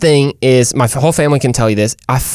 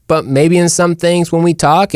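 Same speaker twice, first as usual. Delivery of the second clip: normal talking voice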